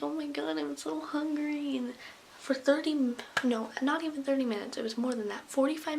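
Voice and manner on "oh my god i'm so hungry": mock misery. Kids kept from lunch, mock miserable complaint. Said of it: "all so miserable".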